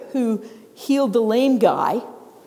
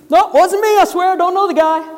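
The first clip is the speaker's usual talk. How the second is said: louder and higher, fast; insistent denial; 7 semitones higher, 6 dB louder than usual. Acted. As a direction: protest too much. Peter's second, louder denial.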